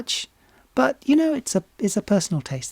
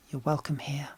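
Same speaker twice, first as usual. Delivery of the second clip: whispered, much softer, tender welcome; 8 dB quieter than usual. Real, not acted. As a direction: whisper it gently to the outsiders. Welcoming viewers who do not fit.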